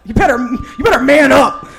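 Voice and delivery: loud, high-pitched mock pep talk; mock urging. Comic urging someone to man up, loud mock exhortation. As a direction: push it out like a hard exhortation.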